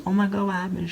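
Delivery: high-pitched imitated shock; mock alarm. Sarah coming back to find [name] hurt, mock alarm. Acting out friend finding her hurt.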